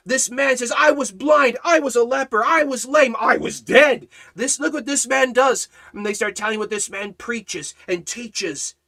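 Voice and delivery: rising, loud revival preaching with voice climbing high; full-force preaching. Acted. Preacher building the scene of the cross for the congregation, thundering. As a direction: preach it to the crowd, building until you're shouting.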